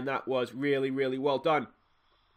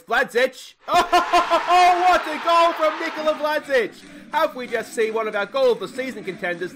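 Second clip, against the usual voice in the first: high, loud laughing shout of joy; thrilled manager laughing and yelling at a fifty-yard goal; 13 semitones higher, 12 dB louder than usual. Real, not acted. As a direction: cheer and laugh at the wonder goal. Wonder goal scored.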